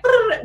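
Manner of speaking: sudden high-pitched brrr buzz; a loud, high alarm-like brrr; high excited buzz. Retold or imitated. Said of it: "my business mind can't help but be like".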